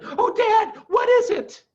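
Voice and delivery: very high, loud excited little foxes; cubs eager. Acted. Cubs ask Dad his idea, high excited. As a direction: beg to know at once.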